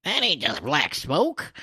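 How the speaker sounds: high-pitched villager voice, drawn out; alarmed villagers. Acted. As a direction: put on a startled onlooker's voice. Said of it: "They comment".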